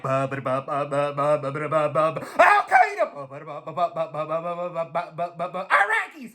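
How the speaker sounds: stuttering engine as a halting presidential speech; George Bush stammer. Retold or imitated. Sputtering engine mocked as a stumbling speech. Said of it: "sounded like George Bush".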